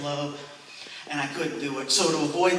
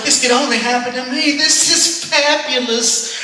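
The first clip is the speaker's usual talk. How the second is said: mock delight; 7 semitones higher, 7 dB louder than usual. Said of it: "And I was like".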